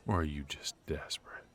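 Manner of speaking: fast breathy taunting whisper; sly mocking whisper; teasing whisper. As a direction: whisper the jab quickly, close in.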